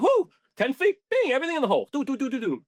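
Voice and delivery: high, gleeful replay of every putt falling; giddy excitement. Retold or imitated. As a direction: hum it like a victory tune. Reliving hot putting streak.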